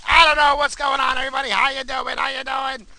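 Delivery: hyped host greeting. Shown as a demonstration. Host retrying his opening with a loud, high hype. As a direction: blast the hello like a radio host. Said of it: "I'm trying something".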